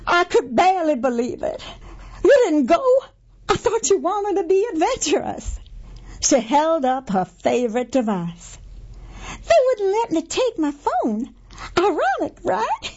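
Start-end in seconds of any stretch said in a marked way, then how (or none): 2.2-5.6 Gertie voice. A narrator's reading. 9.5-12.9 Gertie voice. A narrator's reading.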